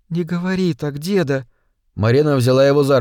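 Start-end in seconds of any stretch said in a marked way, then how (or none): 0.1-1.5 put-on soft, higher young woman's voice; tender, hushed plea; pleading granddaughter. A narrator's reading. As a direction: gently plead with a dying grandfather.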